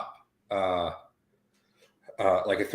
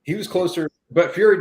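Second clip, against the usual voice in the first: higher and louder, real excitement; excited; 9 semitones higher, 8 dB louder than usual. Real, not acted. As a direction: blurt it out, amazed. Excited fight talk.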